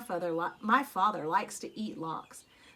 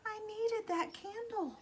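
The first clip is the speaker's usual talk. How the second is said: longing; 11 semitones higher, 8 dB quieter than usual. Acted. Child longing for a junk candle.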